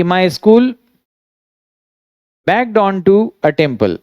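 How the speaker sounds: slow, spaced-out English words with pauses; slow dictation. Shown as a demonstration. Teacher writing out the translation for students, slow dictation. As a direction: dictate it slowly, phrase by phrase.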